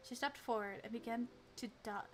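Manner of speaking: usual speaking voice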